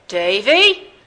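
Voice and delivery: loud summons with rising tune; foghorn call. A narrator's reading. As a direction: call the boy over in a showman's boom. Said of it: "Philip Astley turned his foghorn towards the boy".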